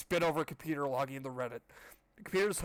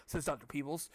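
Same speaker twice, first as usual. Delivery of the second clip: ordinary delivery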